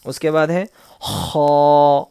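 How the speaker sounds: ordinary talk, nothing marked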